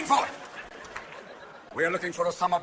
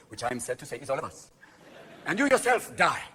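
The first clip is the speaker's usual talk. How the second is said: ordinary delivery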